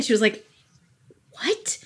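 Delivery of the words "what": a breathless, whispered, higher what. Retold or imitated. Receptionist whispering her confusion at the client. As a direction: mouth the word in baffled disbelief.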